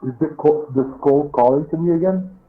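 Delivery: ordinary delivery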